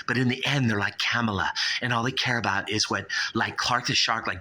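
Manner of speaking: usual speaking voice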